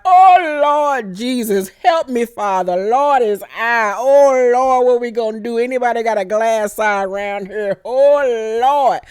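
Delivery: slow dramatic church-lady lament; wailing grandmother. Retold or imitated. Alicent panicking over her son's eye, wailing to God. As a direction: wail to the Lord. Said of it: "So Alice is like".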